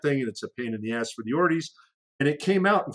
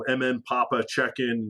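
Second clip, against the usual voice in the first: ordinary talk, nothing marked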